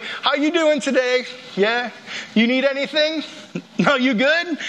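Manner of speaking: sweet-talking the pie lady, hinting; fishing for pie. Retold or imitated. Acting casual to get pie.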